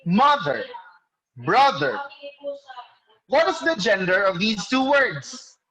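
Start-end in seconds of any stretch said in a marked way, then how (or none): 0.1-2.0 "mother", "brother", each punched on its first syllable; stress on first syllable. Shown as a demonstration. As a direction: model MO-ther, BRO-ther as separate words. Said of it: "falls on the first syllable".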